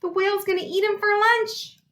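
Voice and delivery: high, loud frantic cry; panicked cry. A narrator's reading. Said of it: "Rita cried".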